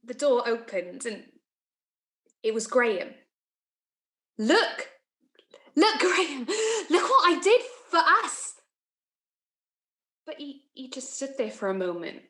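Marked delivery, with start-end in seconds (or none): 4.4-8.5 high-pitched, eager, laughing through it; elated appeal. Acted. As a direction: show it off to him, giddy. Excitedly shows Graham her deed.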